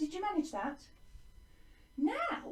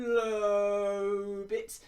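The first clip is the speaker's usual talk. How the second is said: "low" held long and heavy; drawn-out low word; 3 semitones lower, 6 dB louder than usual. Shown as a demonstration. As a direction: stretch LOW out long and loud. Acts out low part of line.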